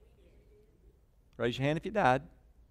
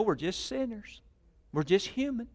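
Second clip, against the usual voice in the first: high, soft imitation of churchgoers; mock excuse-makers; 9 semitones higher, 4 dB quieter than usual. Preacher mimicking what people say, mock-meek. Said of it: "how many of you heard people say".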